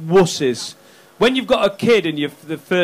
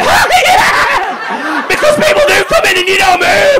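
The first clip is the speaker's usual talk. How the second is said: shrieking much higher and louder with laughter; mock audience cheer; 14 semitones higher, 5 dB louder, slower than usual. Comic mimicking an audience parent's delight, laughing shriek. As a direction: whoop it like a delighted fan. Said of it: "you were like".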